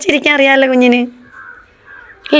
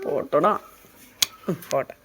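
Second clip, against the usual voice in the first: soft comforting; 6 semitones lower, 8 dB quieter than usual. Real, not acted. Mother comforting hurt child.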